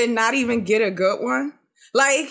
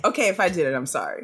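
lower, softer mumbled half-apology; grudging apology; 7 semitones lower, 3 dB quieter, much faster than usual. Retold or imitated. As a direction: trail off sheepishly. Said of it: "And he's like".

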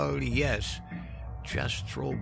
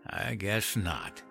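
put-on slow, softer old man's echo; scornful echo; 2 semitones higher, 7 dB quieter, much slower than usual. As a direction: repeat it drily, drawing it out. Henry echoes the meek concession.

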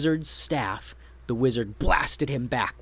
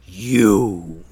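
low, loud troll accusation; troll turning on the wizard, low and loud; troll yell; 6 semitones lower, 7 dB louder than usual. A narrator's reading. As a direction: growl it at the wizard. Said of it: "pointed his club and yelled".

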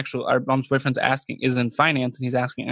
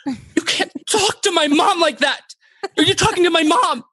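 over-dramatized Megan; 13 semitones higher than usual. Retold or imitated. Brother mocking his sister's outburst at the salesman, shrill. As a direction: ham up a furious sister, pitch way up. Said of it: "This is what it sounded like to me".

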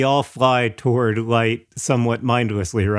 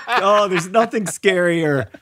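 higher voice breaking into laughter; laughing; 6 semitones higher, slower than usual. Real, not acted. Mocking co-host's fake British accent, laughing. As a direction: tease through a laugh.

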